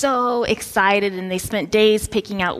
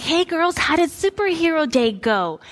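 put-on high, upbeat sing-out to the kids; acted chirpy mom; cheery mom greeting; 8 semitones higher than usual. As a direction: ask it brightly, pitched well up. Cheerfully greeting her kids after school.

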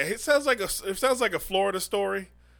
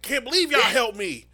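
mock ungrateful man; 6 semitones higher, 4 dB louder than usual. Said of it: "It's like".

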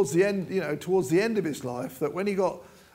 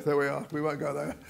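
usual speaking voice

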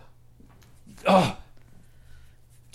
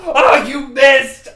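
laughing; 5 semitones higher, 9 dB louder, slower than usual. Acted. Laughing despair at miss.

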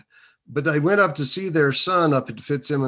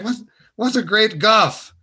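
put-on puzzled young self; 9 semitones higher, 7 dB louder than usual. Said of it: "And I was like".

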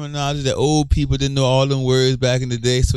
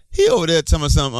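quick slangy quote; 7 semitones higher, faster than usual. Retold or imitated. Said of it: "but they say".

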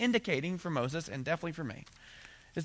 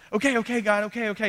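imitating Moses; 6 semitones higher, 4 dB louder, faster than usual. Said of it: "And so Moses goes".

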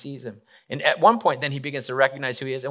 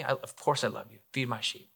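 very soft, heartfelt exchange; hushed sincerity; 10 dB quieter than usual. Retold or imitated. Peter and Jesus at the third asking, hushed and sincere. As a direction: drop right down, quiet and sincere.

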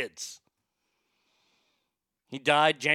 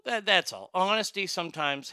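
normal talking voice

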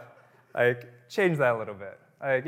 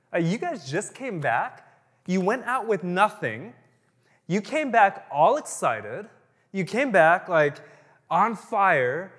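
Jesus, fired up; 5 semitones higher, 8 dB louder, slower than usual. Retold or imitated. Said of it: "He was like".

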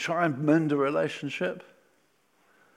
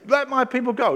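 bold demand; 8 semitones higher, 5 dB louder than usual. Acted. Moses to Pharaoh, acted.

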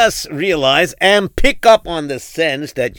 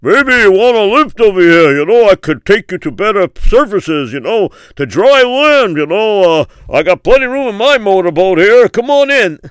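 louder, higher friendly boatman's call; motorboat rescuer; 4 semitones higher, 6 dB louder than usual. Acted. Rescuer calls to the man on the roof, loud and higher. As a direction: hail the stranded man from the boat. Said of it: "he says".

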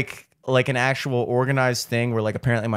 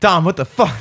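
laughing; 7 semitones higher, 7 dB louder than usual. Retold or imitated. Retelling friend opening door.

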